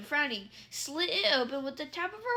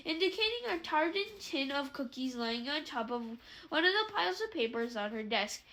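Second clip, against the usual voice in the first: plain reading voice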